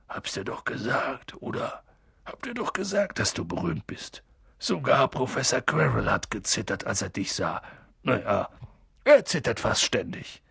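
low, soft, husky aside; easygoing big man, voice down; chummy giant gamekeeper. Acted. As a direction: say told-you-so to a young friend, quietly pleased. Hagrid chats with Harry.

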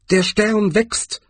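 hoch und langsam, staunend; anderer Troll. Acted. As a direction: ein Troll bemerkt den Stern. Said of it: "warf ein anderer Troll ein". (hohe, langsame Trollstimme)

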